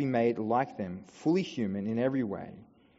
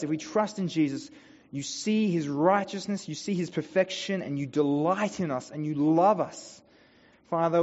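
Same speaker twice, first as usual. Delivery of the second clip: prayer; 2 semitones higher, 4 dB louder than usual. Acted. Pastor praying over his church, earnest closing prayer. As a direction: pray it over the congregation with feeling.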